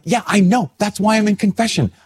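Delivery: high, exasperated mock retort; retold angry retort. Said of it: "And I was angry".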